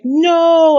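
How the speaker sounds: high, loud drawn-out protest; dramatic cry. Acted. Reader acting out a manga character's horrified cry. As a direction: cry it out in mock horror.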